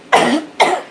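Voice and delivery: rough cough clearing throat; coughing on a crumb; choking cough. Real, not acted. Food went down wrong pipe.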